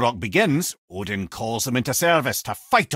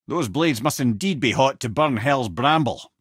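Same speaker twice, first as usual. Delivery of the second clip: normal talking voice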